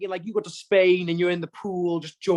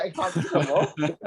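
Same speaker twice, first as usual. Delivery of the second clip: laughing. Retold or imitated. Exhausted after swimming.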